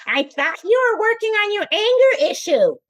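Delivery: high, sing-song teasing husband voice; husband catching his wife out, in a mocking high voice. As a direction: throw it back at her like a smug husband. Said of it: "he says".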